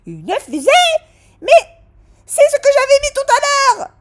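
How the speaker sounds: very high, loud, fast yell; waitress screaming, high and quick; shouting waitress. Acted. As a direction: yell back at him. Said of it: "elle a crié".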